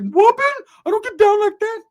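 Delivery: very high, loud cartoon squeak. [name] squealing at his cousin in a Mickey Mouse voice. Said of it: "from Mickey Mouse to [name]".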